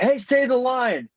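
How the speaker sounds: high, slow call-out; eager fan request. Retold or imitated. Fan asking the actor for his line, eager. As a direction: call it out high, egging him on. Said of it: "met Gary Busey and said".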